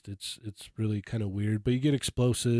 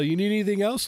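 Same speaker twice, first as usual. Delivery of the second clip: higher-pitched eager question; eager clerk; 10 semitones higher, 4 dB louder, faster than usual. Retold or imitated. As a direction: imitate a helpful clerk offering more.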